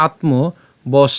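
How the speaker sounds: আত্মবশ spoken very slowly; slow syllable demo. Shown as a demonstration. Teacher breaking down আত্মবশ very slowly. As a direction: drag out আত্মবশ. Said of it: "আমরা এখানে কি করছি".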